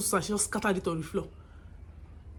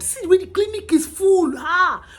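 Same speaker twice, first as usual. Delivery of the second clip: much higher and louder, fed up; exasperated shout; 9 semitones higher, 7 dB louder than usual. Retold or imitated. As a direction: yell in exasperation at the chaos. Staff exasperated at crowded clinic.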